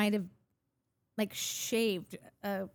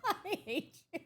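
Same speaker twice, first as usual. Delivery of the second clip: soft, higher, through laughter; laughing repeat; 4 semitones higher, 8 dB quieter than usual. Retold or imitated. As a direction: giggle out the quoted jab. Repeating the joke while laughing.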